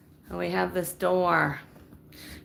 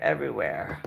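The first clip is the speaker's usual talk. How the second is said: everywhere sinking much lower and softer; low, fading groan; 11 semitones lower, 4 dB quieter than usual. Real, not acted. Showing her friend the messy closet, sinking low and weary. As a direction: let everywhere drop in despair. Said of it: "I have stuff everywhere".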